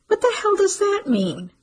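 shocked question. Acted. Mother recoiling at her daughter's word, high and shocked. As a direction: recoil and fire back in disbelief.

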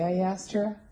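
ordinary talk, nothing marked

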